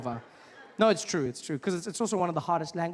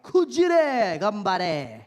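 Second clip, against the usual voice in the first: mock Japanese; 3 semitones higher, 6 dB louder, much slower than usual. Shown as a demonstration. Mock Japanese phrase, slow.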